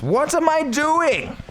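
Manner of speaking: high, slow, drawn-out whine; hammed-up high lament; mock wail. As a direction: wail it like a lost hero. Silly high wail in game.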